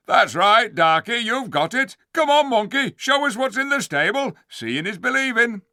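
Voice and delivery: high, loud heckling voice; jeering dwarf. Acted. Dwarf heckling the ape, loud jeer. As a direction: heckle the monkey.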